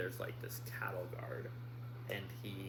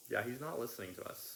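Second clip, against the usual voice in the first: normal talking voice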